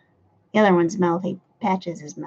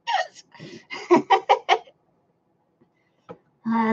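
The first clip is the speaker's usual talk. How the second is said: ordinary delivery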